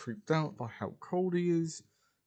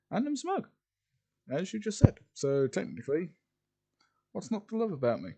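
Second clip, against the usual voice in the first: pitched-up, mildly smug boast; lightly acted smugness, higher at the start; 6 semitones higher than usual. A narrator's reading. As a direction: play it smugly self-satisfied, voice lifted. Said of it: "And he got smug".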